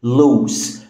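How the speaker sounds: ordinary talk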